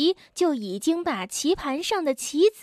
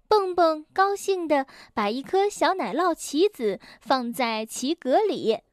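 plain reading voice

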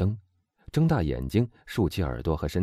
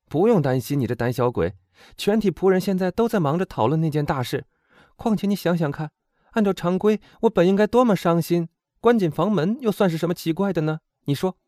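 put-on high, emphatic young woman's voice; spirited heroine arguing her case, high-pitched; bold teasing heroine; 11 semitones higher, 5 dB louder than usual. A narrator's reading. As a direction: brush off a timid friend's worries. Voices Eugénie reassuring her timid friend.

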